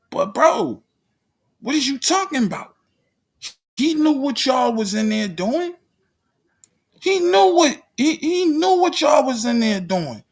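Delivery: high-pitched disbelief, fast at first; you can't believe what you're hearing; calling out Mark in high-pitched disbelief; incredulous outburst. Real, not acted.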